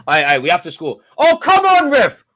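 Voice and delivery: very high, very loud outraged yell; furious shout. Real, not acted. Fan screaming at the ref over a free kick, furious.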